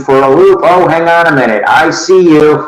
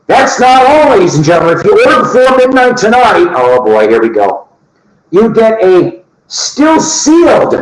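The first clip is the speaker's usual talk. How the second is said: higher, ad-announcer voice, then slowed; infomercial pitch; 4 semitones higher than usual. Pretend. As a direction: sell it like a late-night TV ad.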